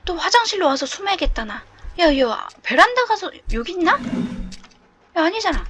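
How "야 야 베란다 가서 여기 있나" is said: normal talking voice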